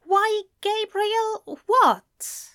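high, slow, puzzled query; careful, drawn-out confusion; puzzled friend. A narrator's reading. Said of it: "tilting his head slightly".

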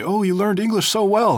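high, slow, mocking tourist; lightly imitating a gushing tourist. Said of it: "they say".

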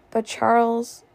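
ordinary delivery, nothing marked

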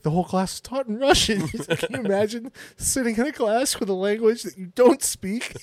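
comic's incredulous bit, hammed up; Bert Kreischer bit. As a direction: sell the punchline like a stand-up. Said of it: "that Bert Kreischer thing".

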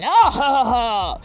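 mock belly laugh; laughing big cat. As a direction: laugh off the little fella's biting. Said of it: "lion laughing".

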